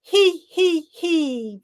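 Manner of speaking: tittering giggle up high. Shown as a demonstration. Coach leading the group in a high he he he. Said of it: "Breathe in".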